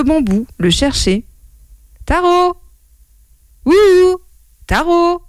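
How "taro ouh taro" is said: high, carrying singsong call. Acted. Mother searching the bamboo for her son, high calling. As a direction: call out for a lost child, high.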